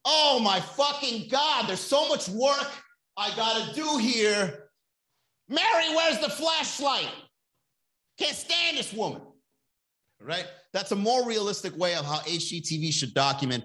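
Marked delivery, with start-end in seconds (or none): 0.0-9.2 high, slow, exasperated whiny rant; mock-yell for the flashlight, much higher; frustrated renovator. Mocking real renovators: high, exasperated whine. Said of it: "They're gonna be like".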